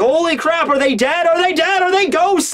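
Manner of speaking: high-pitched panicked mock shout; Luffy. Luffy shouting to crewmate at the graves, panicked. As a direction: fire off the questions in rising alarm. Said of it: "shouting to Law".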